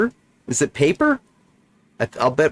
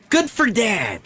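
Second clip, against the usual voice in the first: Good for Dad! jumps much higher, bright; excited repeat; 12 semitones higher than usual. Real, not acted. Player cheering for the game's dad, pitched way up.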